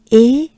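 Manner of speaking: E like the end of café; French E sound. Shown as a demonstration. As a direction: send E up to the palate. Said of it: "is pronounced by the air you exhale goes up".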